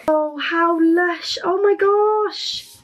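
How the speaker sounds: high, slow squeal of delight; real delighted swoon; thrilled gushing. Real, not acted. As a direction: stretch it out, rising into a squeal. Opening the sticker envelope.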